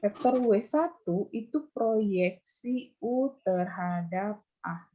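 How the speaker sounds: slow, measured dictation to a class; slow repeated definition. Real, not acted. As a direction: draw out each word of the term. (mendikte definisi dengan pelan)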